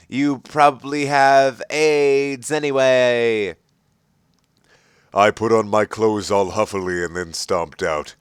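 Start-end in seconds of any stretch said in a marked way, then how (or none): 0.1-3.6 slow, drawn-out retort; understated shout; mock angry jab. A narrator's reading. Ebony insulting Draco as she leaves, slow mock anger. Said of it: "I shouted".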